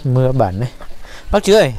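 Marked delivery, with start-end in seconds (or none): 1.3-1.7 higher-pitched call for someone's attention. Real, not acted. Visitor hails the neighbour on arriving, calling out. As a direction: hail the neighbour from a distance.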